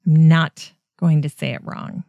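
ordinary talk, nothing marked

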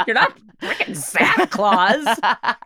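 laughing. Real, not acted. Laughing at deep voice.

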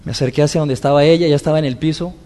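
ordinary talk

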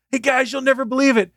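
high, loud call to the guys; excited announcement. Retold or imitated. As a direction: act out spreading amazing news. Guy announcing Batman to coworkers.

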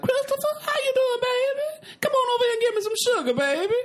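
very high, fast, doting auntie; Southern auntie voice. Retold or imitated. Family greets Christopher in a very high, doting auntie voice. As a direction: coo at him like a loving Texas aunt. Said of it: "the whole family, like".

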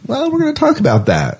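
high playful sing-song; teasing. Real, not acted. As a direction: tease it in a high lilt. Teasing the Summer Glau curse.

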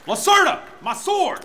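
high summons by name; calling out. Acted. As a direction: call her over. Knight calling servant.

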